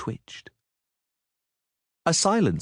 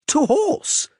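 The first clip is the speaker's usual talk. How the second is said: loud, much higher rallying cry; 6 dB louder than usual. Acted. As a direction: call everyone to mount up. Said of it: "cried Dandelion".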